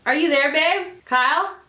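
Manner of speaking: high, loud call to another room. Real, not acted. Vlogger calls to boyfriend in the bathroom, calling out. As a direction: call out to check who can hear. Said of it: "you're screaming in there".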